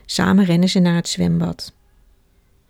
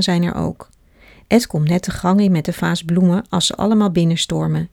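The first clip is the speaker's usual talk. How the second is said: ordinary delivery, nothing marked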